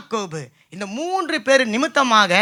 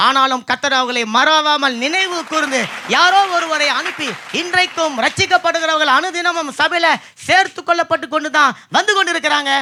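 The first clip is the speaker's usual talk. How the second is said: higher, driving sermon delivery; impassioned preaching; 5 semitones higher than usual. Real, not acted. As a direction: preach it out with rising fervour. Preaches at a peak. (உணர்ச்சி பொங்கும் பிரசங்கம்)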